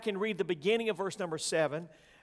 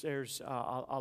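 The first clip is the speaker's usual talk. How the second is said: usual speaking voice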